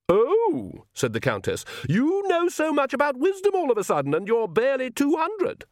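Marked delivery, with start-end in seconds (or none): 0.1-0.9 high, swooping exclamation; a haughty mother's high mock surprise; scornful Countess. A narrator's reading. Countess scoffs at her son. 1.9-5.7 very high, haughty matron voice; mock the son from far up in head voice; scornful Countess. A narrator's reading. Countess mocks her son.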